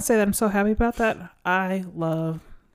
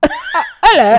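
[name] impression; 13 semitones higher, 10 dB louder, much faster than usual. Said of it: "she's like".

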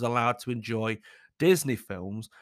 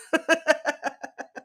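laugh. Real, not acted. Laughing at her own joke.

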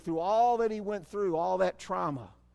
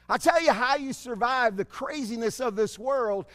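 ordinary talk, nothing marked

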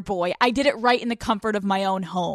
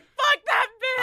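high, slow mock yell; hammed-up outraged outburst, pitched way up; mock furious shout; 12 semitones higher, slower than usual. Host voicing her friend's fury at the ex, mock shout.

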